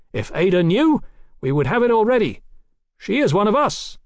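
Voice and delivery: high, insistent, projected voice; shouted rebuttal. A narrator's reading. Captain insists the lady is an ally, shouting. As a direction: loudly insist across a distance. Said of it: "Swing shouted".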